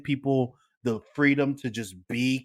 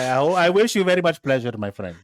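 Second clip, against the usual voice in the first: put-on Indian accent. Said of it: "Why are you doing it in the Indian accent?".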